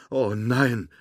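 a short, startled outcry; alarmed cry. A narrator's reading. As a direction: call out in sudden dismay. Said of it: "rief Woody".

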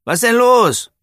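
put-on high, loud boy's call across the pitch; a boy calls over from the field, loud and high. A narrator's reading. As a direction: shout the question to a teammate. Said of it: "rief Bossi".

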